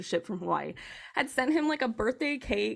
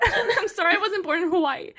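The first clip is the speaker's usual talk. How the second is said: laughing; 8 semitones higher, 4 dB louder than usual. Retold or imitated. Husband voiced, laughing.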